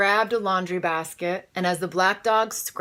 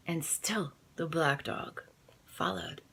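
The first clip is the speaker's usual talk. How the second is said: hushed suspense whisper; 7 dB quieter than usual. A narrator's reading. Narrator whispering the dog's pursuit. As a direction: drop to a whisper.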